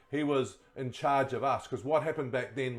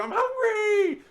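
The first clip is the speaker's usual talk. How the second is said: put-on high, loud, drawn-out child's whine; whiny kid; 8 dB louder, slower than usual. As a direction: whine it like a hungry kid begging. Kids whining for food.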